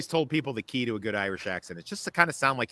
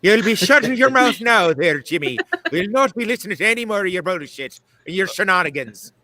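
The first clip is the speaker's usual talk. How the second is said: put-on high, loud scolding in an Irish accent; Irish nun; 7 semitones higher, 6 dB louder than usual. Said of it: "something about those nuns beating the shit out of me".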